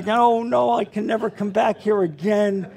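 ordinary delivery

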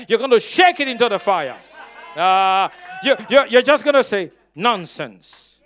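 loud, forceful declaration; said like you mean it. Shown as a demonstration. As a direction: belt it out with conviction. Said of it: "say it like you mean it".

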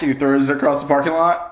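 ordinary talk, nothing marked

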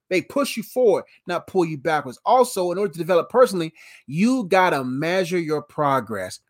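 usual speaking voice

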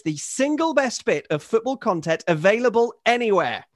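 emphatic, articulate reading of a grand letter; email read aloud. Acted. Host reads Henry's fulsome praise of the pod, formal read-aloud. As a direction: read it out with rhetorical lift on key phrases.

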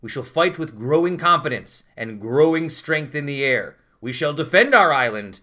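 slow, loud, grand speech delivery; dramatic reading. Shown as a demonstration. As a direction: declaim it like a wartime leader. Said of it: "I'll give you a dramatic reading".

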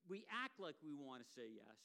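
usual speaking voice

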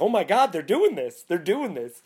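high, loud thrilled disbelief; a fan thrilled a scene made the film; excited gasp. Retold or imitated. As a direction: squeal like a delighted fan. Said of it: "And I was like".

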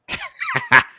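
real high, helpless giggle; cracking up; high-pitched laughter. Real, not acted. Cracks up attempting a different voice.

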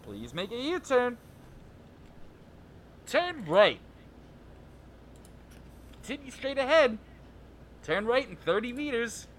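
robotic navigation announcer; GPS voice. Acted. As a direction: read it like a sat-nav. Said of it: "you wanna do the G P S voice?".

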